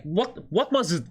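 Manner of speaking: usual speaking voice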